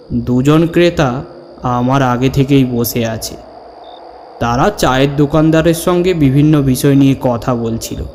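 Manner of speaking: plain reading voice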